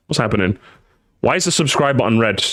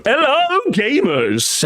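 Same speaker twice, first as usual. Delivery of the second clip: intro greeting; 10 semitones higher, much slower than usual. Acted. Intro for video recording.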